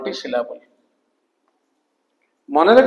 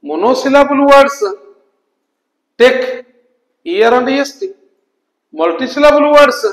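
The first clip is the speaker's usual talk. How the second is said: higher, louder and slow rule summary; emphatic recap; 5 semitones higher, 8 dB louder than usual. Shown as a demonstration. Teacher drilling the rule into students, loud and slow. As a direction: hammer the rule home. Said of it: "clear?".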